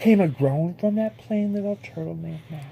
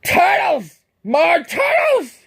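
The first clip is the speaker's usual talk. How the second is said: higher, loud braying shout; bellowed; 8 semitones higher, 7 dB louder, slower than usual. Acted. Yertle bellows down to the pond for more turtles. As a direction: bray for the turtles like a donkey. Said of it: "he bellowed and brayed".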